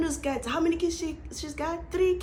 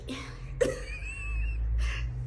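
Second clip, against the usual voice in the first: shrieking high laugh; 6 dB quieter than usual. Real, not acted.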